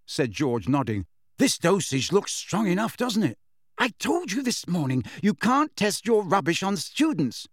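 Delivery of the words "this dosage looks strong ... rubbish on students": scolding. A narrator's reading. Hermione scolding the twins.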